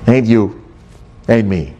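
ordinary delivery

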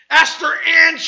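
high, loud calling shout; mom yelling full name. Retold or imitated. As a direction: yell the child's full name like a mad mom. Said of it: "your mom, he call you by three names".

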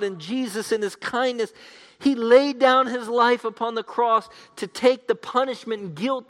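high, loud pulpit appeal; impassioned preaching. Real, not acted. Preacher urging listeners to join Jesus's team, impassioned preaching. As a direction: preach it urgently at full force.

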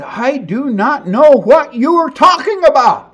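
Peter's last angry denial to his accusers; final denial. Acted. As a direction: hammer out each word in exasperation. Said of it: "he said".

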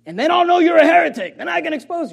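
loud, high gotcha; mock-eager accusation. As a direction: pounce like a gleeful heretic hunter. Mocks eager heresy hunter.

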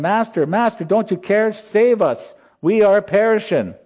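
high, pleading cry for rescue; panicked shout. Retold or imitated. Disciples waking Jesus in the storm, high panicked pleading shout. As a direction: call out like men sinking in a storm.

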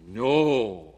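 a single NO, hit hard; emphatic no. Shown as a demonstration. Preacher showing Paul's emphasis, punches No. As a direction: stress No as the first word, held out. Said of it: "it's emphatic".

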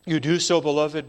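normal talking voice

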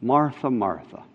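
low, soft, gentle repeat of the name; Jesus gently calling the angry Martha, tender; tender rebuke. Retold or imitated. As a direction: say her name kindly, almost hushed. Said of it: "kindness and tenderness ... the voice".

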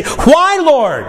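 high anguished why, then falling; venting cry. Retold or imitated. Believer venting pain to God, high anguished cry. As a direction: cry out the question to heaven. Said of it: "when you stand and say".

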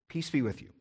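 soft and hushed, a quiet blessing; calm the frightened men with a whisper-soft peace; gentle Jesus voice. Acted. Jesus to his panicking disciples, soft and hushed. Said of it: "he's just simply saying to them".

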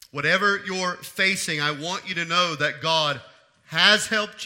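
loud, rising preacher's cadence; stirring sermon delivery that builds in intensity; full-force preaching. Acted.

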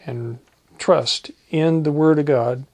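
ordinary talk, nothing marked

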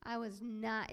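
ordinary talk

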